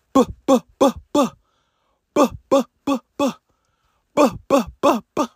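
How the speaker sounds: rapid high plosive buh bursts; imitated gunfire. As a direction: mimic an assault rifle burst by mouth.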